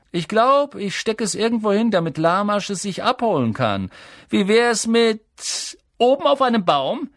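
high, loud bully's teasing; gloating schoolboy jeer; mocking taunt. A narrator's reading. Bully taunts, holding the prize.

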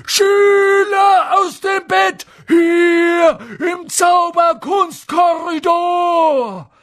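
very high, slow, full-voiced shriek; bellowing. A narrator's reading. Peeves betraying students in the corridor, bellowing. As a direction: stretch the alarm out at full volume. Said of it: "brüllte Peeves".

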